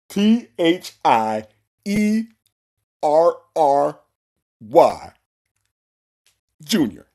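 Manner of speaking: slow, loud letter-by-letter imitation; mock his laboured spelling, one drawn-out letter at a time. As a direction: spell it out slowly with long pauses. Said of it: "That's how you spell it".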